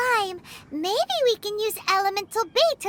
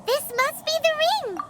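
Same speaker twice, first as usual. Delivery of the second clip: bright, high cry of triumph at finding it; thrilled to have found the ring; excited discovery; 6 semitones higher than usual. Acted. Paimon finds the missing ring, excited.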